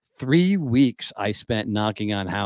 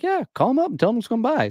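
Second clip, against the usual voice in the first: acting out her high, eager go-ahead; high-pitched urging; 14 semitones higher than usual. Lady giving salesman the go-ahead, high-pitched urging. Retold lady urges him to phone.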